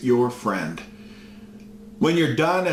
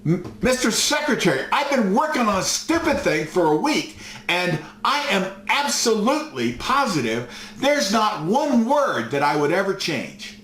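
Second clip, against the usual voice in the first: higher, strained, fed-up retort; exasperated outburst; 7 semitones higher than usual. Retold or imitated. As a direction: let the frustration spill out, pitched up. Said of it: "Lord just loses it on the phone".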